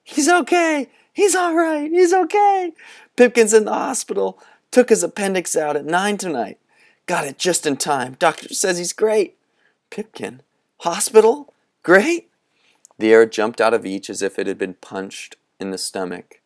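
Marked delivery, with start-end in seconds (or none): 0.0-9.4 much higher, louder relieved shrieks; shrieking with joy. A narrator's reading. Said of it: "He ran along the sidewalk shrieking". 9.9-12.3 much higher questioning gasps; can't believe what they heard; stunned disbelief. A narrator's reading. Boys stunned by the news.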